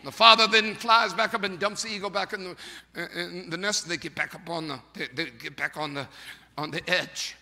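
usual speaking voice

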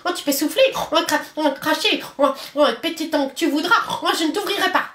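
small, high defiant pig voice; a tiny piglet taunting the wolf; little pig refusing the wolf, high and cheeky; little pig's voice. Acted. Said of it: "répond une petite voix".

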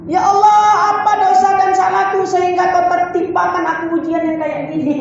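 demanding prayer. Retold or imitated. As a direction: demand an answer from God, indignant. Tanya pula dia.

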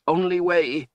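put-on high, strained old wizard; gasped. A narrator's reading. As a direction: strain the words out high, as if gasping. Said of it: "Zed gasped".

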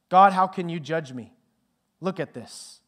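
loud accusing cry at God; shouting. A narrator's reading. Woman with the tattoo shouting at God, loud accusing cry. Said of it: "and shouts".

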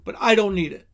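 ordinary talk, nothing marked

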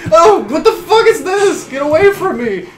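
high, loud, exasperated fright; panicked yelling. Real, not acted. Player still chased by the monster, panicked yelling. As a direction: yell to be left alone.

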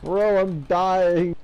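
drawn-out panicked cry; panicking gamer. Real, not acted. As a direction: stretch it out in alarm. Low health in game, panicking.

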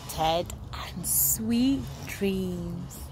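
slow, hushed whisper; whispered goodnight. Acted. Reader saying goodnight to Ted, slow whisper.

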